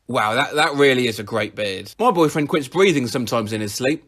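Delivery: normal talking voice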